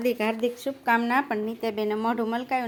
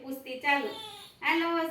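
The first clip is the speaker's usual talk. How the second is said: normal talking voice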